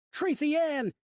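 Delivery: calling out loud and high; a father yelling for his lost daughter; shout. Acted.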